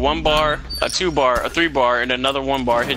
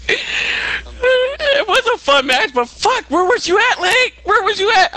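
high, louder excited ribbing; high excited callout at partner; excited teasing; 5 dB louder, slower than usual. Real, not acted. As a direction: rib your partner, pitched up and loud. Teasing partner after match.

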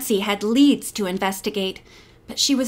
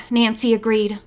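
plain reading voice

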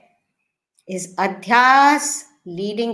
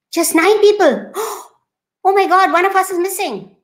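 high gasping shock at finding only nine; gasp, then panic over the missing friend; gasping alarm; 8 semitones higher, 3 dB louder than usual. Acted. Friend gasps at the count in alarm.

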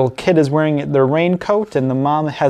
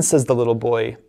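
ordinary delivery, nothing marked

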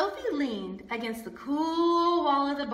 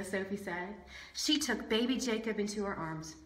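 ordinary delivery, nothing marked

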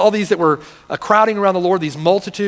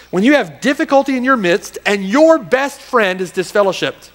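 raised, driving sermon delivery; impassioned preaching; 4 semitones higher, 3 dB louder than usual. Real, not acted. Preacher challenging the church to stay loyal, impassioned preaching. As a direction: fire rhetorical questions at the congregation.

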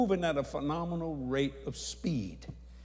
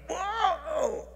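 high, drawn-out whoa; imitated holler; 13 semitones higher than usual. Preacher sucked out of the room, high hollered whoa. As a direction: cry out high as if swept away. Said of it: "I mean, I literally hollered".

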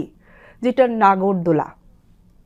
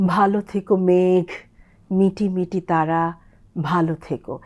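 slow poetic reading, stretching the words; drawn-out poem recitation; 3 semitones lower than usual. Shown as a demonstration. Teacher reciting a poem to show aspiration, drawn out. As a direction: recite it tenderly, drawing out ভালো. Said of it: "সবসময় একটু টেনে ড্রল করে বলতে হয়".

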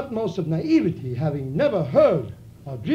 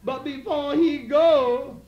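high, breathy-free coy purr, much higher; coy woman's voice; 9 semitones higher than usual. Acted. Woman coaxing her daddy, high coy voice. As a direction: play the sly woman sweet-talking her man. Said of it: "She says".

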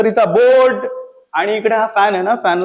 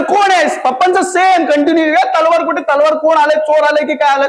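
high, loud angry shout; furious dad; 9 semitones higher, 4 dB louder than usual. Retold or imitated. Dad shouting at a supposed intruder, furious. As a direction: bellow at the intruder.

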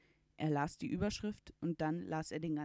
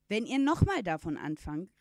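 loud, forceful warning; called out; 8 dB louder than usual. A narrator's reading. Uncle threatens the boy's parents, calling out loudly. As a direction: call out a threat at full voice. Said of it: "rief Ringelhut".

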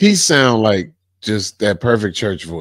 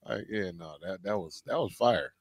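ordinary talk

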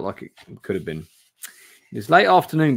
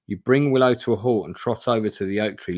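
ordinary talk, nothing marked

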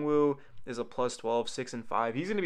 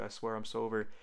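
usual speaking voice

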